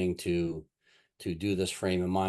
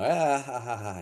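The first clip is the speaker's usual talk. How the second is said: imitated group chuckle; 5 semitones higher than usual. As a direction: imitate a room chuckling along. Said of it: "everybody chuckled, you know".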